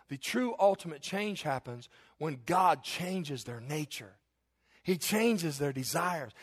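normal talking voice

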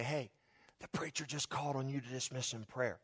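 low, very soft confidential nudge; quiet mock whisper. Churchgoer waking a dozing neighbour as a prank, quiet whisper. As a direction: lean in and murmur it very quietly. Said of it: "very quietly whisper".

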